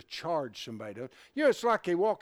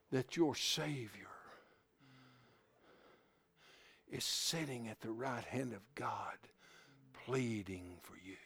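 whisper; 4 semitones lower, 9 dB quieter than usual. Real, not acted. Preacher whispering to the congregation about the Savior. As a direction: drop to a whisper to draw them in.

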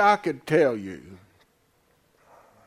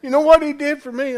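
usual speaking voice

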